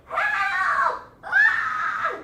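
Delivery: very high, loud shriek for help; screaming for his life. Acted. Fortunato walled in, screaming for his life. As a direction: scream desperately for rescue. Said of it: "screaming for his life".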